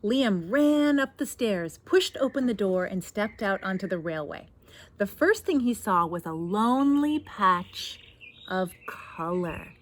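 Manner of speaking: put-on lively read-aloud to children; story narration. A narrator's reading. Reads picture book aloud.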